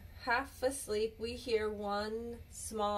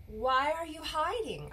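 gentle question; 7 semitones higher than usual. Acted. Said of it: "I ask".

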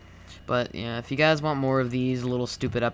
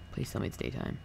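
whispered plea; 7 dB quieter than usual. Real, not acted. Begging the game at night.

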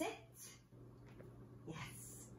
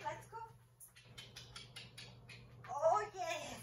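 ordinary delivery

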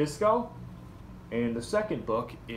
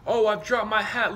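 dismayed hedgehog; 8 semitones higher, 6 dB louder than usual. Acted. Hedgehog loses hat.

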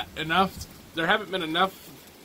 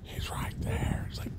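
whisper; 11 dB quieter than usual. Real, not acted. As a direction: whisper so it won't run. Whispering near the animal.

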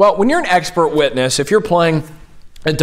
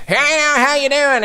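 hillbilly impression; 10 semitones higher than usual. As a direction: greet them like a hammed-up hillbilly. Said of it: "talking like you're, you know, a hillbilly".

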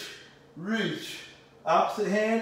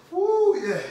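high, loud cheer; excited cheer; 13 semitones higher, 4 dB louder than usual. Real, not acted. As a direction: whoop them on. Trainer cheering class on.

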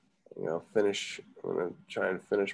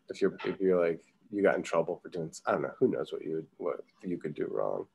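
ordinary talk

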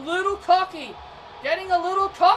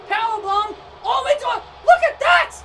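much higher, louder rising yell; excited outcry; 5 semitones higher, 8 dB louder than usual. Real, not acted. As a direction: build to a shriek of disbelief. Commentator erupts at finisher.

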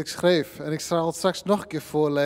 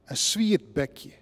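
measured poem reading, line echoed by line; bilingual poem recital; 3 semitones higher than usual. Acted. Recites poem, Frisian and Dutch alternating. (gedragen tweetalige gedichtvoordracht)

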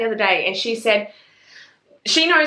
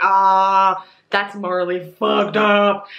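mock shock; 3 dB louder, much slower than usual. Acted reader reaction to shocking events.

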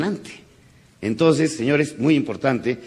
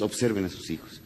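softer, lingering repeat; 5 semitones lower, 11 dB quieter than usual. Shown as a demonstration. Speaker repeats advice to the fathers, softer. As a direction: drop the voice to make it sink in. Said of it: "Señores".

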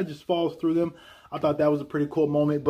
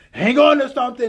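a touch louder and higher mock call; mock repeat; 8 semitones higher, 8 dB louder than usual. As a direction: echo the warning. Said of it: "repeats it".

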